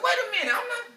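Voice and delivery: laughing shocked husband; shocked protest. Retold or imitated. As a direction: pitch up in startled disbelief.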